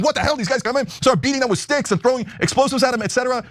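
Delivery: a hint of mock alarm; mock outrage. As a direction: play the outraged newsman, lightly. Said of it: "getting all upset and going".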